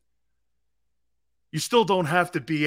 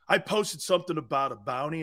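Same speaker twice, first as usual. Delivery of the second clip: ordinary delivery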